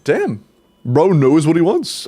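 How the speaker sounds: normal talking voice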